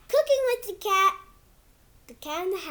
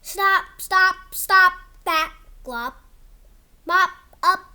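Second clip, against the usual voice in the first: louder, calling stop stop; 7 dB louder than usual. Acted. Reader shouting the book's stop stop stop, louder. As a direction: call out to stop the mess. Book character yelling stop.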